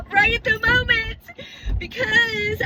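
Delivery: laughing through the words. Real, not acted. Laughing at her own lateness.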